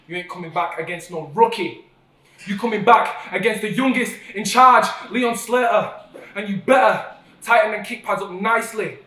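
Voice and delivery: roaring wrestling promo callout; shouted callout. Real, not acted. Wrestling promo, calling out rival.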